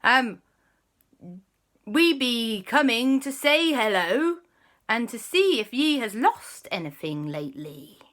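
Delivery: put-on loud, hearty pirate accent. Kwasi greeting the mermaid guard in a loud pirate accent. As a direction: ham up a jolly pirate. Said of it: "Quasi said".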